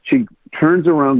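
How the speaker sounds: ordinary talk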